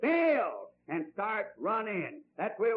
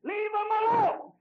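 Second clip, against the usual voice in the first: rebellious cry; 12 semitones higher, 7 dB louder than usual. Acted. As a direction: cry out to be left alone. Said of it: "That's the cry of men today".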